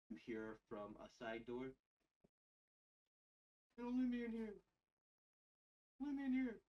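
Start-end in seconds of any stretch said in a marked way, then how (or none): 3.8-6.6 high, louder, pleading cry; desperate plea. Acted. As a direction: beg from behind a door. GM voices trapped prisoner.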